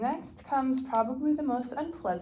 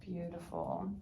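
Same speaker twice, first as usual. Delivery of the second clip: ordinary delivery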